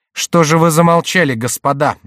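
commanding, with a touch of threat; menacing demand. A narrator's reading. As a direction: challenge them like an offended prince. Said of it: "произнёс Франсуа грозным голосом".

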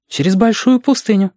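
put-on high, earnest child voice; serious child. A narrator's reading. Reshka proposing a desert crossing to Alexander, serious child voice. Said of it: "сказал Решка вполне серьёзно".

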